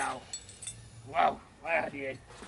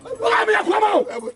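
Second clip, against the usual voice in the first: angry shout; 12 semitones higher, 4 dB louder than usual. Acted. Angry quarrel with boss.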